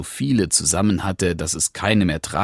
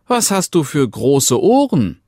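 put-on little girl; 8 semitones higher, 5 dB louder than usual. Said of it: "rief es".

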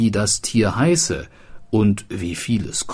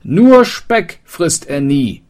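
called out loud and high while running, slow; hasty call; 9 semitones higher, 8 dB louder than usual. Acted. Fleeing wanderer calls back to the townsman, hasty shout. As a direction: shout it back over your shoulder. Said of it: "rief hastig".

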